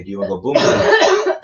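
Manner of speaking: imitating Thai bar girl. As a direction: punch out "boom boom" like the bar girls say it. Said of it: "They say".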